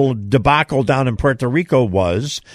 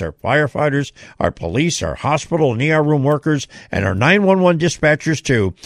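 ordinary talk

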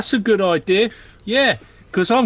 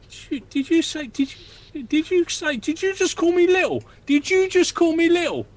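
higher, affronted repeated questioning; indignant disbelief; 8 semitones higher than usual. Acted. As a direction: bristle at being called little. Offended dwarf bristles at "little.

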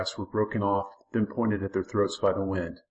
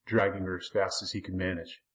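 plain reading voice

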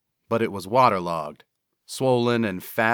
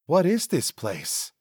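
higher, nervous big man's voice; Cecil's voice; 7 semitones higher than usual. Acted. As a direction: voice the worried Cecil up high.

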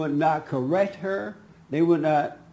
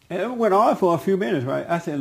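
ordinary talk

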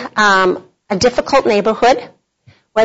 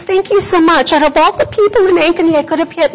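higher, quick, gushing thanks; grateful driver; 6 semitones higher, faster than usual. Retold or imitated. Crash driver thanking the woman she hit, higher gushing gratitude. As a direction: gush your relief and gratitude. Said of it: "And she goes".

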